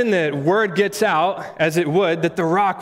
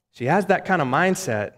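normal talking voice

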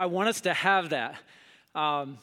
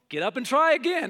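mom's saying; 7 semitones higher, 5 dB louder than usual. Retold or imitated. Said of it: "my mom's thing".

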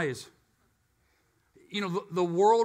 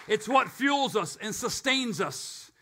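ordinary talk, nothing marked

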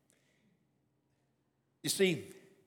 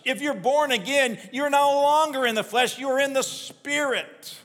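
higher and louder, hammering each point home; full-force preaching; 8 semitones higher, 6 dB louder than usual. Acted. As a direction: preach it hard to the congregation. Preaching climbs to a peak.

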